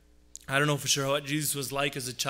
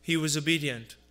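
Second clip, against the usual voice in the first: louder, rising pulpit voice; fervent preaching; 3 semitones higher, 5 dB louder than usual. Acted. Pastor preaching to his congregation about Jesus' family, fervent. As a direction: drive the point home from the pulpit.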